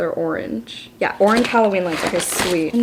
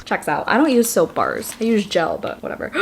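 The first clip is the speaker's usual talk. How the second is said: ordinary delivery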